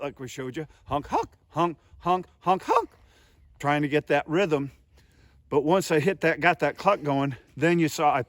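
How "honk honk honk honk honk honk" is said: 1 goose honks. Shown as a demonstration. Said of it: "Changed the pitch of my cluck a little bit".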